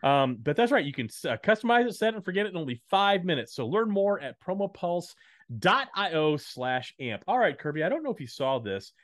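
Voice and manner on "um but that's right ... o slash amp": a hint of the carnival barker. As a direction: lighter high pitchman read. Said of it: "some weird Carnival Barker voice there".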